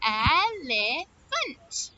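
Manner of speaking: "Elephant" in three separate beats; syllable by syllable. Shown as a demonstration. Teacher claps word with children, "Elephant" in three beats. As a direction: say El, e, phant with pauses between. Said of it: "Let's see".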